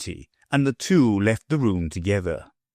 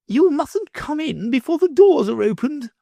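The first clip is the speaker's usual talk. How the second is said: high, loud noblewoman rebuke; stern lady; 4 dB louder than usual. A narrator's reading. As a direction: correct him sharply. Lady voiced by reader.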